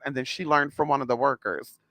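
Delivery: ordinary delivery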